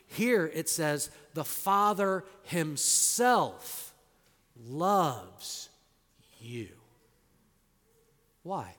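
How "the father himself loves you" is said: slow, weighty stress on "The Father himself"; "The Father himself" drawn out, stress on Father; emphatic Father himself. Shown as a demonstration. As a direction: land each word of "The Father himself" slowly. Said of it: "this is really emphatic in the Greek".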